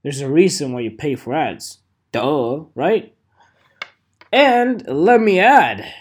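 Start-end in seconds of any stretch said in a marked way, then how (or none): none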